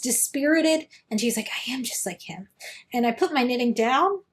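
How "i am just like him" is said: whispered. Retold or imitated. Dispirited Mabel's inner quote.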